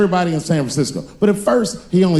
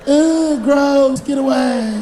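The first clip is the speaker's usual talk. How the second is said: disgusted woman; 10 semitones higher, much slower than usual. Acted. Woman rejecting hero.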